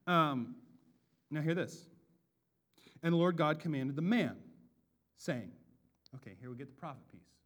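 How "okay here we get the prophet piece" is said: ordinary talk, nothing marked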